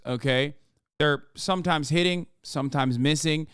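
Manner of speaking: normal talking voice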